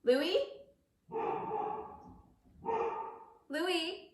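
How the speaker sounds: calling out. Real, not acted. Calling out through house.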